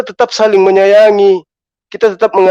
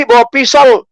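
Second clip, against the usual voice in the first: ordinary talk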